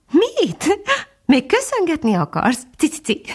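high, amused bird voice with chirps; cheerfully laughing titmouse. A narrator's reading. Titmouse brushing off the dog's thanks, high merry chirping. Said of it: "nevetett a cinege jókedvűen".

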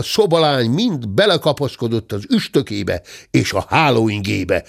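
ordinary delivery

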